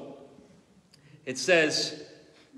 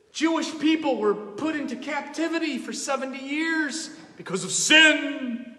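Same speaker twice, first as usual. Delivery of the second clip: fiery preaching; 7 semitones higher, 5 dB louder than usual. Acted. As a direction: thunder it from the pulpit. Preaching on sin at full force.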